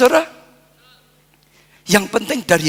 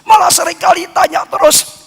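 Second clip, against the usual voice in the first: fervent preaching; 6 semitones higher than usual. Acted. Pastor telling parents a child's love is enough, fervently. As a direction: press the point with lifted pitch.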